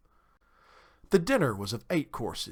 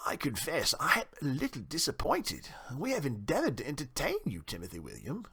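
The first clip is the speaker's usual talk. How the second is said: formal, wounded host, softening at the end; refined town mouse, mildly hurt; Johnny Town Mouse, disappointed; 2 semitones higher, 4 dB quieter than usual. A narrator's reading. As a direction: confess disappointment with stiff politeness.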